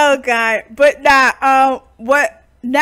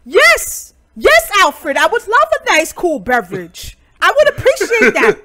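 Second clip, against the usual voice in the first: mock grand lady; 6 semitones higher, 4 dB louder than usual. Playing madam answering the butler.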